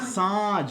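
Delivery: साँझ with a long nasalized आ; साँझ, held and nasal; nasal vowel. Shown as a demonstration. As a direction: send the vowel of साँझ into the nose. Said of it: "आ रहा है ना नाक पे".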